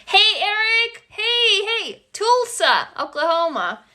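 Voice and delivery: high, sing-out hello; excited greeting. Real, not acted. Host spotting Eric among the viewers, excited high greeting.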